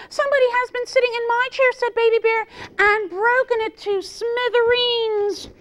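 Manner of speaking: very high, fast squeaky little bear, outraged; tiny bear wailing about his broken chair; baby bear voice. A narrator's reading.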